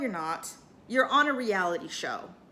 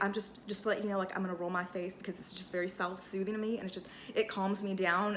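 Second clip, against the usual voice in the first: soft self-soothing; 4 semitones lower, 8 dB quieter than usual. Retold or imitated. Sutton explains soothing face roller.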